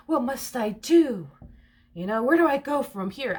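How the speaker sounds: high, loud mock despair; hammed-up dramatic. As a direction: plead like a melodrama heroine, stretching it out. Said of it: "they're very dramatic".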